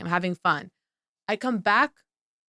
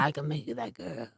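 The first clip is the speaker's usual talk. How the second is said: soft aside; 6 semitones lower, 11 dB quieter than usual. Real, not acted. Teasing promise.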